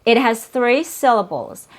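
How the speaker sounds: normal talking voice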